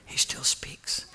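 slow whispered truth. Real, not acted. Preacher assuring the congregation, whispering. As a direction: let it sink in softly.

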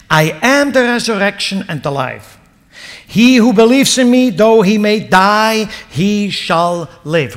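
loud, high proclamation, slowing at the end; preaching at full force. Acted. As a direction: thunder out the words of Jesus. Preacher proclaiming Jesus' words.

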